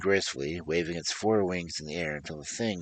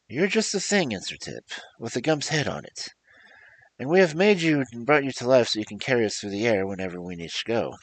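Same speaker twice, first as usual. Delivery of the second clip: put-on a boy explaining, higher and quicker; lightly voiced eager boy; 6 semitones higher, 5 dB louder than usual. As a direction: tell it brightly what it was made for. Tip answers the Gump.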